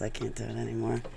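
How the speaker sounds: usual speaking voice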